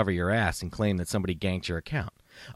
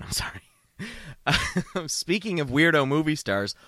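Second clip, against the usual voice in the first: ordinary delivery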